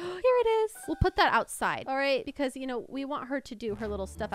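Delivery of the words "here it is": happy find. Real, not acted. Finds the kite.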